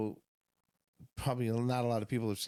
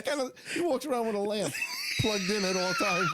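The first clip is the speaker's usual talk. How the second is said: laughing. Real, not acted. Joking about the cop's lamp.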